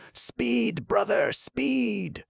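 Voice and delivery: very high, drawn-out cry; urging. A narrator's reading. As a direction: urge him on with long, keening calls. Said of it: "Still she urged him".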